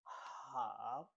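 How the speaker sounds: Mandarin third tone on HOW, falling then climbing. Shown as a demonstration. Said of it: "The third tone".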